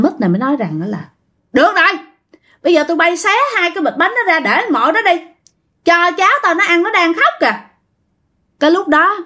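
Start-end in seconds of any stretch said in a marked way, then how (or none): 1.5-7.6 high, urgent grandfatherly request; relenting old ghost. Retold or imitated. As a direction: urge them on for the crying grandchild. Ghost orders food for crying grandchild. (giọng ông ma cao, giục lo cho cháu)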